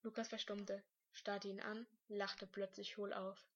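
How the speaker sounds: ordinary delivery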